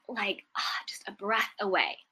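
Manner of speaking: hushed, awed whisper of a close call; breathless whisper. Retold or imitated. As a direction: let it out in an unvoiced breath, still shaken. Retelling near miss with fire.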